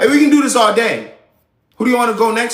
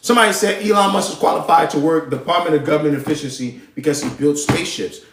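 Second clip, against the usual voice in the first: normal talking voice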